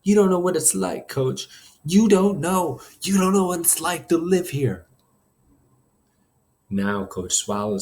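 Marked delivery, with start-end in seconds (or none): 0.0-4.9 high, anguished insistence; upset kid on the verge of crying; near tears. A narrator's reading. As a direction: hurl it at him, hurt and pleading. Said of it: "tears were welling up in my eyes".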